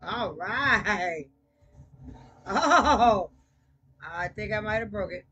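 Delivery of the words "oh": loud laughing "ohh"; loud "oh" outburst. Real, not acted. Player crashes the car, loud laughing cry. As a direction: burst out with a long loud oh.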